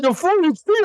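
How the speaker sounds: high-pitched disbelief; incredulous. Retold or imitated. Lead squad leader reacting to the retreat, retold incredulity. Player stunned by the retreat order.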